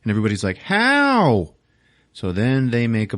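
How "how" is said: high mock cry of dismay; incredulous cry. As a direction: cry out high, as a baffled crowd. Whole group reacts in dismay.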